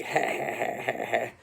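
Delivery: breathy, staccato mock cackle; acted villain laugh. Luigi over his tied-up captive, breathy villain laugh.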